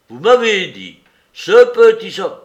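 plus aigu et bien plus fort; martèle son accusation; insistance criée. Acted. Soupe insiste en criant. (Soupe entêté crie sa version à Larrier)

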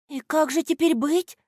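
high, worried little voice; волнение. A narrator's reading. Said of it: "заволновалась Аля". (взволнованно, высоким голосом)

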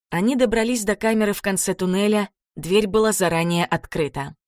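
ordinary delivery, nothing marked

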